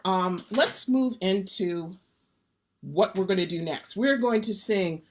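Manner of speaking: normal talking voice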